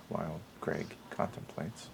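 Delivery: hushed, very soft golf commentary; whisper-soft like a golf broadcast; golf announcer. Acted. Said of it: "Golf, golf announcer".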